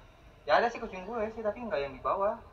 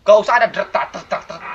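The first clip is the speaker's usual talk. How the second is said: loud, high burst of protest; startled outburst; 6 semitones higher, 14 dB louder than usual. Real, not acted. Player protests a noise in a horror game, startled and loud. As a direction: blurt it out loudly at the game.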